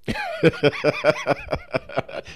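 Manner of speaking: real amused laughter; hearty high laugh. Real, not acted. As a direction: laugh at the memory. Ends story.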